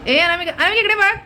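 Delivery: high-pitched call for attention; high, insistent pet-owner call. Real, not acted. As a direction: call the naughty pet to look at you. Calling the pet to look.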